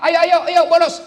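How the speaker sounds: high, loud coaxing schoolmate; playful high call to play truant; mock tempting friend. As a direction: egg him on to skip class. Friend tempting child to skip school.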